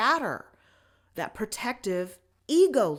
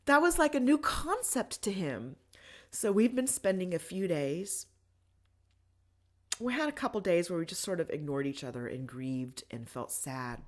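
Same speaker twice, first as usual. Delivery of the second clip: normal talking voice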